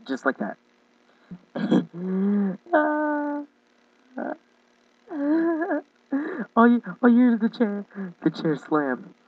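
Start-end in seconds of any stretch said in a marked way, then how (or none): 1.3-3.4 loud, high burst of laughter; laughing fit. Real, not acted. Cracking up at their own sound effect, loud high laugh. 6.6-9.1 loud, high, laughing delivery; talking through laughter. Real, not acted. Retelling the chair slam to viewers, cracking up loudly.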